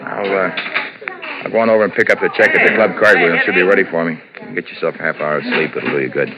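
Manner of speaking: loud, hearty reassurance; forced cheer. Acted. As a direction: sell the lie brightly. Husband covering his plan.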